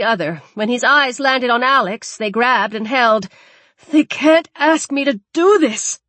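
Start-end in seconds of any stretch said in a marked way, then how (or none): 3.9-6.0 anguished protest. Acted. Man forced to choose victim.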